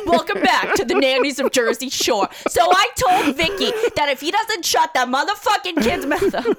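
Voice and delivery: high, hammed-up Jersey Shore nanny; mock a reality-TV opening with laughter underneath; reality show voice. Pitching a Jersey Shore nanny reality show, hammed-up voice. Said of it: "could actually be a really good reality show".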